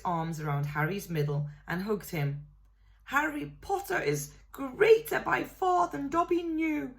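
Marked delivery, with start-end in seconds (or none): 3.0-7.0 high, tearful elf voice; choked with emotion; sobbing elf. A narrator's reading. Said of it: "he sobbed".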